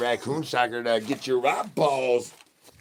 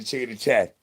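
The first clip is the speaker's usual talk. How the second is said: ordinary talk, nothing marked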